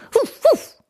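high happy puppy woofs; happy barking. Acted. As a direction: bark joyfully like a puppy. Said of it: "freut sich Max".

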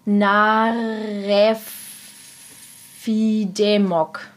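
word reversed syllable by syllable; backwards speech. Shown as a demonstration. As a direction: piece it together backwards, slowly. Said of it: "Comedy-Veranstaltungen rückwärts". (Silbe für Silbe rückwärts)

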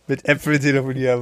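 laughing through the words. Real, not acted. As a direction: chuckle while repeating it. Repeats the joke comment, amused.

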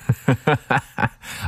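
short amused laugh. Real, not acted. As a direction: burst out laughing at him. Laughs at friend's numbness.

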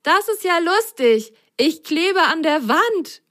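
high, bright-eyed little sock; high childlike sock voice amused; playful sock voice. Acted. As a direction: play the giggly little sock. Sock character speaks, story.

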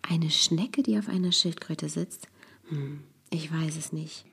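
hushed, playing along; whispering. Real, not acted. Father whispering back to his daughter, playing along. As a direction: whisper the riddle back, puzzled.

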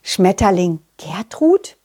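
ordinary delivery, nothing marked

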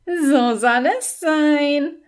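high, slow fluting toad voice. Acted. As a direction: sing it out high and drawn out like a magical toad. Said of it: "flötete die Unke".

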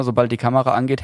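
ordinary talk

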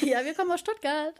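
high, soft, quick mock repeat; teasing echo. Partner echoes the line teasingly.

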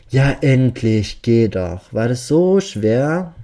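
slow, loud sarcastic relief; mocking. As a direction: drag it out to mock him. Killer mocks the hesitant friend.

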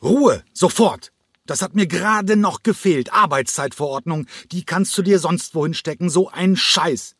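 high, furious roar; bellowing. A narrator's reading. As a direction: explode at the dancer in rage. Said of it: "begann John Cranko zu brüllen". (Cranko brüllt die Tänzerin wütend und hoch an)

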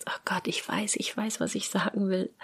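whispered, searching for a word; whispered panic. Retold or imitated. Tired speaker stuck on a missing word, whispering. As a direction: whisper it like a thought you're stuck on. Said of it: "dass du wirklich denkst".

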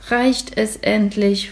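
slow, weary "Reicht es endlich?"; slow, sleepy question; tired. Acted. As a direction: drag it out like he's worn out from scrubbing. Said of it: "fragt Florian müde".

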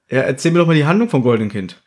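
ordinary talk, nothing marked

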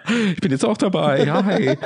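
high laughing joke greeting. Pretend. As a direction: giggle through a high hi. Plays late joiner laughing.

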